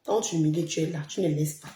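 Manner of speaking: ordinary talk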